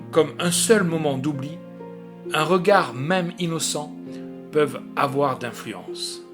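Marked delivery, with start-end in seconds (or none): none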